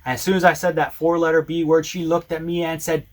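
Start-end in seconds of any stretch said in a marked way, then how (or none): none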